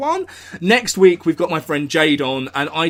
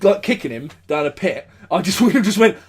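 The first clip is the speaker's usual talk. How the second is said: normal talking voice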